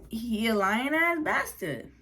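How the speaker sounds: ordinary delivery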